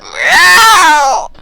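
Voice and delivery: shrieking high yowl of a dying cat; dying cat meow. Retold or imitated. Kid imitating the neighbor's cat dying, screeching meow.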